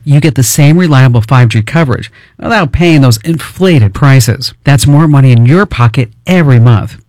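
usual speaking voice

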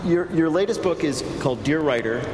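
usual speaking voice

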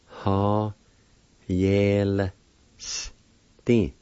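"Ha, yel, sti" with long gaps; syllable by syllable. Shown as a demonstration. Teacher showing how penknife is said, syllable by syllable. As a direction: sound out "Ha, yel, sti" slowly. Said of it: "It's pronounced like this".

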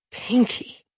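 breathless whisper of the name. A narrator's reading. Frightened sister whispering beside her, hushed whisper.

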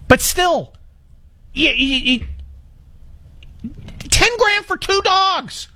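aghast. Real, not acted. Host tallying his dog bills for callers, aghast. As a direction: spell out the outrageous sum slowly.

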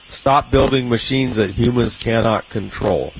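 usual speaking voice

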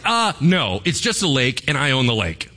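breezy, teasing God brushing off the idea; laid-back deity shrugging it off. Retold or imitated. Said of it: "He's like".

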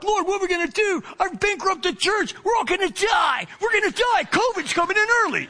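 high, frantic cry to the Lord; pleading like the church is doomed; panicked prayer. Retold or imitated. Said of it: "I'm totally freaking out".

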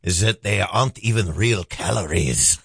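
slow, hammed-up French accent; heavy put-on French. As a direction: read it in a drawn-out mock French. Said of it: "I got French".

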